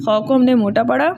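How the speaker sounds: ordinary talk, nothing marked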